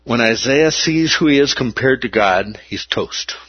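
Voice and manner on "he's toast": hushed aside; whispered aside. Acted. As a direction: drop to a whisper.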